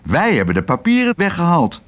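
high-pitched squabbling retort; mild, high cry of protest; calling out. A narrator's reading. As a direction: argue back in a high little voice. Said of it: "riep Toep de Mus".

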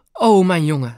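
dad crying out as his son runs in; exclaiming. A narrator's reading. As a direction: call out to the son in relief. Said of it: "riep hij uit".

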